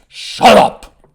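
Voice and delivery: explosive high, loud goblin shout; roaring goblin command; thundering goblin. A narrator's reading. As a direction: thunder it out to silence a rowdy group. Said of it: "One of the adult goblins thunders".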